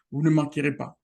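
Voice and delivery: ordinary talk, nothing marked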